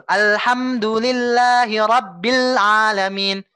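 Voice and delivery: slow, fully drawn-out Quran recitation; perfect tajwid recitation. Shown as a demonstration. Teacher shows the student the perfect recitation, slow and drawn out. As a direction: chant each sound to its full length. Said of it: "ini yang sempurna gitu".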